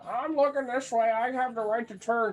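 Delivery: high, put-on old lady voice; mock old granny. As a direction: play the oblivious granny driver, voice pitched well up. Old granny driver voiced in story.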